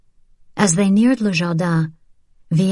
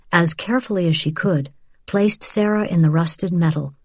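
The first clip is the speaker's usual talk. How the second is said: usual speaking voice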